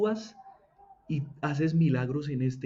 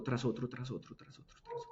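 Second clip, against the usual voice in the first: softer and softer until whispered; fading to a whisper; 3 semitones lower, 12 dB quieter than usual. Acted. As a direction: let "tras otro" die away into a whisper. Listing tests that fade away.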